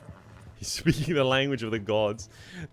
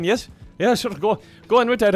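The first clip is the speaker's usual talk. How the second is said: loud, high thick Irish agreeing; mock yes-yes while lost, broad Irish; nodding-along Irish; 9 semitones higher, 8 dB louder than usual. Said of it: "I'd just be saying".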